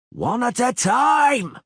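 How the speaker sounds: high, loud, slow drawn-out command; yelled. A narrator's reading. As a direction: bellow over the crowd. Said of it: "yelled the bandaged soldier".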